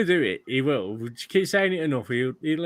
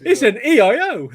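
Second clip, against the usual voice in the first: laughing while teasing, loud and high; 8 semitones higher, 10 dB louder than usual. Real, not acted. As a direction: giggle through the words.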